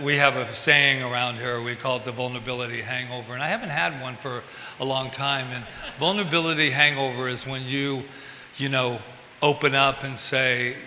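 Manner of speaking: ordinary delivery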